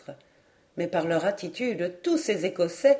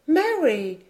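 high, falling call; exclaimed name; 7 semitones higher than usual. A narrator's reading. Lady Helena stopping Mary from leaving, crying out. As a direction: call after her, pitched up. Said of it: "s'écria lady Helena".

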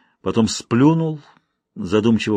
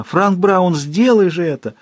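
higher-pitched goading dare; ironic plea; 6 semitones higher, slower than usual. A narrator's reading. Woman goading the man to do it, ironic plea. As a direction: beg him tauntingly, pitched up. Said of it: "полилась её ирония".

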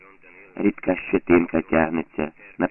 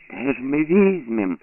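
high, slow despairing plea; 7 semitones higher than usual. A narrator's reading. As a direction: plead helplessly. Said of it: "з розпачем сказала".

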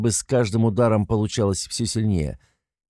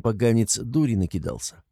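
ordinary delivery

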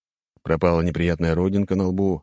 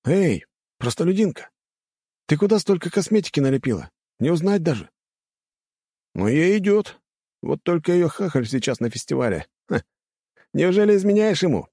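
higher sneering lad voice; mocking rich boy; 11 semitones higher than usual. A narrator's reading. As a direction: taunt her like a spoiled aristocrat. Arrogant students mocking her.

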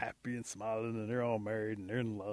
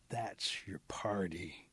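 slow, soft whisper; confiding whisper; 4 semitones lower, 4 dB quieter, much slower than usual. Acted. Rabbit grabs Possum to explain the noise, confiding whisper. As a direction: whisper it slowly, letting each word land.

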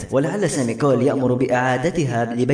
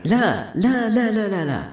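narrator's restrained hysteria, pitched way up; hysterical cry; 9 semitones higher than usual. A narrator's reading. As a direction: cry it out in high panic without shouting. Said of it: "وهي تصيح بهستيريا".

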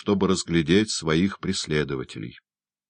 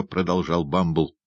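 plain reading voice

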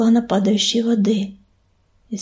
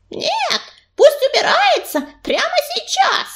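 very high, piercing angry squeal; hissing outburst; 14 semitones higher than usual. A narrator's reading. Tribe mate demands the outsider leave, high angry hiss. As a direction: spit it out in a high fury. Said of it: "зашипела Кисточка, выскакивая вперёд".

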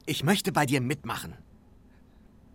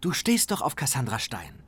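ordinary delivery

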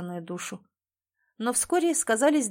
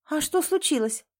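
higher, put-on surprise; feigned surprise; 7 semitones higher than usual. A narrator's reading. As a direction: act surprised without meaning it. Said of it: "наигранно удивлённо добавила".

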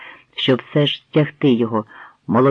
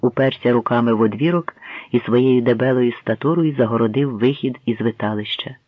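ordinary delivery

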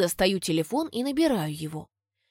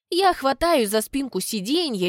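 ordinary delivery

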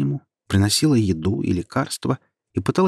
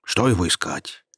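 displeased. Acted. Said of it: "недовольно сказал голос".

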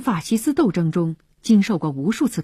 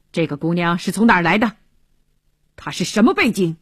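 suspicious questioning; faster than usual. Acted. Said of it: "怀疑目光".

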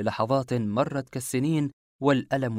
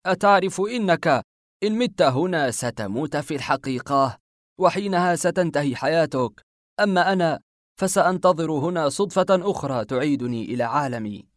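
raised, taunting villain's threat; cruel mockery; 4 semitones higher, 5 dB louder than usual. A narrator's reading. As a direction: sneer at the trapped victim with cold cruelty. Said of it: "قال بسخرية مليئة بالقسوة". (بسخرية مليئة بالقسوة)

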